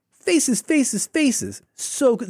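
音调高扬、满心欢喜地连说faces; rising thrill at each page; gushing delight. Real, not acted. Gushing over sticker pages.

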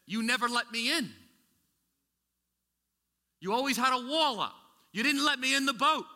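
loud, high preaching voice; preached rebuke. Acted. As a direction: proclaim it as Jesus' complaint. Jesus rebuking, preached quote.

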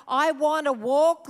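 normal talking voice

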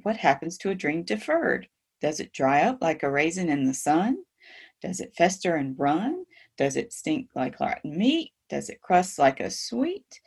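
each question ends on a rise; questions rising. Shown as a demonstration. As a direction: lift the end of every question. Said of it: "All the questions make your, your voice go up".

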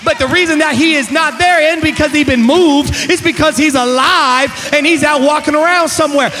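loud, driving gospel preaching; preaching at full force. Real, not acted. Preacher telling congregation he's alive, full-force preaching. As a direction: build it relentlessly and rouse the room.

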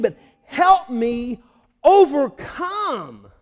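acted cry for help. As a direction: stretch each word in a desperate cry. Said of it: "He's crying out".

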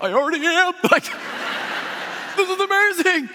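very high, loud squeal; hammed-up giddy excitement; retold overjoyed outburst. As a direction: squeal it like a thrilled guest. Overjoyed reply to bus driver.

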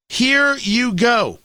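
slow and deliberate; slow, drawn out. Shown as a demonstration. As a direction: stretch every word out. Repeats phrase, second slowed.

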